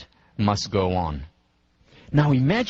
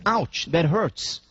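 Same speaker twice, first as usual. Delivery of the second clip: higher, drawn-out mock cry of pain; acted pain; 6 semitones higher, much slower than usual. Shocked confederate complains to you, acted pain. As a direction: stretch out a slow, put-on complaint.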